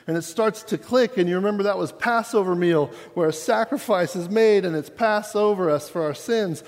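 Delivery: fervent sermon climax; preaching at full force. Acted. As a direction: preach it, swelling toward the resurrection.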